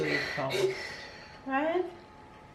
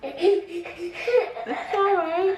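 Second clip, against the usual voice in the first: very slow, high soothing; very slow high comfort for her crying son; 12 semitones higher, slower than usual. Real, not acted.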